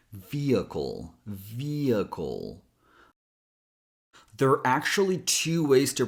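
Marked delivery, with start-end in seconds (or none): 0.1-1.2 "Vehicle" slowly as VEE-uh-kull; whole-word demo. Shown as a demonstration. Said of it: "that's the whole word". 1.3-2.6 "vehicle" drawn out as VEEE-uh-kull; slow word demo. Shown as a demonstration. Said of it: "that's the whole word".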